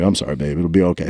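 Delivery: low, tender reassurance, retold; soothing apology. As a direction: comfort an upset child, voice dropped. Retells soothing his embarrassed, tearful daughter.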